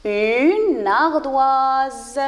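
"une ardoise" stretched right out; very slow model reading. Shown as a demonstration. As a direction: read "une ardoise" very slowly, every sound held.

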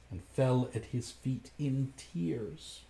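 ordinary delivery, nothing marked

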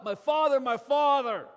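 loud anguished call. Acted. Elisha calling after Elijah taken up, loud cry. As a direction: cry it out to the departing prophet. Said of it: "So he called out".

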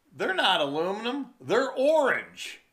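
mocking the guy's answer; mimicking the guy. Retold dumb guy's reply.

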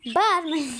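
loud call to stop the shouting. Real, not acted. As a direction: tell the shouting relatives to stop, loudly. Yells at noisy family.

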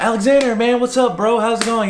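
off-duty petty officer cheerfully greets former recruit; friendly officer. Retold or imitated. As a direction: greet him like an old buddy. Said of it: "completely different from what he was like".

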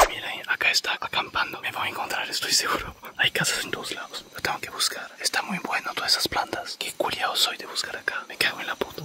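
hushed, voiceless whisper; real furtive whisper, very soft. Real, not acted. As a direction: whisper so nobody nearby catches you. Hiding while searching near campsite houses.

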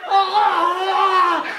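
slow, high mock wailing; childish wail. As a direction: draw out a hammed-up kindergarten cry.

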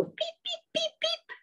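very high, quiet beeps; high little frog beeps; squeaky frog peep. Retold or imitated. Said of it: "some frogs go".

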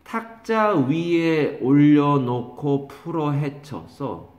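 spaced-out dictation, each word stretched; drawn-out words, pausing between each; slow dictation. Shown as a demonstration. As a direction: dictate the memory phrase slowly for note-taking. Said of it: "뭐라고 쓰냐면은".

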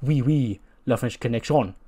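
eager mock French accent. Wrestler answering Kevin Owens in a mock French accent. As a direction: play a pompous Frenchman greeting. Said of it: "And then he's like".

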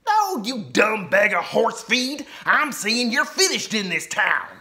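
growling outlaw. A narrator's reading. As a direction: sneer the insult. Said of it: "growled Ten Gun Ben".

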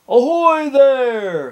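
long, high pirate hail; calling out. A narrator's reading. Pirate in the tree to captain, loud hail. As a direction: holler it from up in the branches, drawn out. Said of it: "called a voice".

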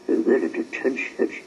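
ordinary delivery, nothing marked

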